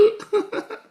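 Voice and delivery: high laugh after clowning; high-pitched giggle; amused laugh. Real, not acted. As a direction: burst out laughing at your own act. Laughs after his mock wail.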